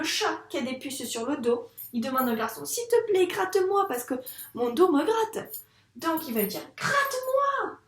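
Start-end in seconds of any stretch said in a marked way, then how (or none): 2.7-5.5 high, loud begging cat voice; pleading cat. Acted. Teacher voicing the cat asking the boy, pleading. 6.8-7.8 very high, slow, stretched cry; exclaimed plea. Shown as a demonstration. Teacher demonstrating the exclamation mark, high pleading cry. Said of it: "avec un point d'exclamation".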